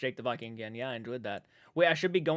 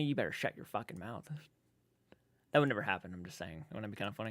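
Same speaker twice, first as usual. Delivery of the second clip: lower, softer telling himself off; a hint of a hushed self-rebuke; muttered self-scold; 7 semitones lower, 9 dB quieter than usual. Real, not acted. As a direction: mutter it under your breath to yourself. Scolds himself after daydream.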